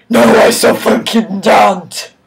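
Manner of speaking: hammed-up shouted denial; a mock outburst, voiced up. A narrator's reading. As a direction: yell it in an over-the-top gothic protest. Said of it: "I shouted".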